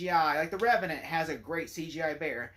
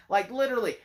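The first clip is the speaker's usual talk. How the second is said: usual speaking voice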